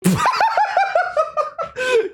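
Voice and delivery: high shrieking laugh; real helpless laughter. Real, not acted. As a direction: crack up at the screen. Erupts laughing at AI story.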